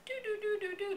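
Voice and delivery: high, soft descending doo-doo-doo; sing-song little steps going down; light "doo-doo" travel noise. Retold or imitated. Storyteller imitating the ride down the escalator, light doo-doos.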